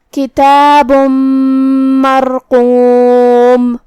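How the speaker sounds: slow, drawn-out chant with merged nasal joins; tajweed recitation. Shown as a demonstration. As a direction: recite each word long, merging the tanween. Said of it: "ہم یہ حکم کو ایسے ادا کریں گے".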